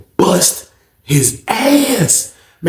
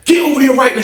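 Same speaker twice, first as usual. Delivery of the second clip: booth lady; 10 semitones higher, faster than usual. Retold or imitated. Booth lady ordering the inmate over, high stern call. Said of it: "She was like".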